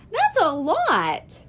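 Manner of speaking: put-on high little-girl complaint; kid complaint. A narrator's reading. Child complaining.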